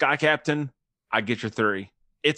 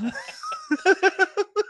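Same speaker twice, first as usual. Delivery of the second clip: high laughing fit; 4 dB louder than usual. Real, not acted. Laughs at own sponsor joke.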